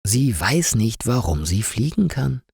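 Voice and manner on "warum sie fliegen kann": usual speaking voice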